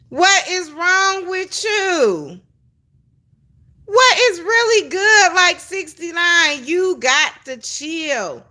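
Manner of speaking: high, drawn-out mock scolding; exasperated disbelief. Telling her you got to chill, exasperated. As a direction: stretch the words slowly in high-pitched disbelief.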